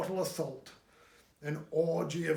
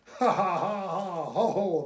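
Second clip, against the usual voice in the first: loud staged "ha ha ho ho"; mock hearty laugh; 4 semitones higher, 6 dB louder than usual. A narrator's reading. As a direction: laugh it out politely. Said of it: "Dixon laughs".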